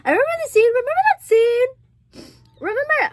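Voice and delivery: high, loud tearful wail. Real, not acted. Recalling a movie scene to viewers, high and tearful. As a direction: wail it through tears and laughter. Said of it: "I'm just crying".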